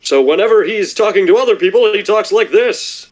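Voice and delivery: high, booming regal knight voice; royal knight voice. Retold or imitated. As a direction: ham it up like a noble knight. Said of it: "like, a royal voice".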